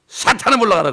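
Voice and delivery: loud and high, commanding the devil out; mock exorcism shout. A pastor casting out Satan, imitated with a loud commanding shout. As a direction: bark the command at the demon like an exorcist.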